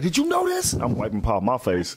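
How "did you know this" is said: high mock outrage from [name]; hammed-up angry girl voice; mock angry high squeal. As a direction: squeal it like a furious woman.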